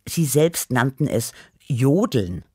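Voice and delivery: plain reading voice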